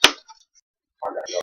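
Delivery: normal talking voice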